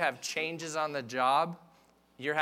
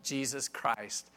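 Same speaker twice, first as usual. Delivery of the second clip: ordinary talk, nothing marked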